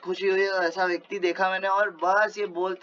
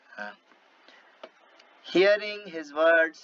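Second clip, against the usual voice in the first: normal talking voice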